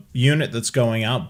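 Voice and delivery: ordinary talk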